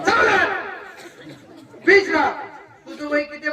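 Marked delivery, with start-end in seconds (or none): 0.1-0.5 high, sharp call to attention; sudden raised-pitch order; drill command. Pretend. Shouted drill command to child. 1.9-2.3 loud, sudden at-ease order; punchy parade-ground command; drill command. Pretend. Shouted drill command to child.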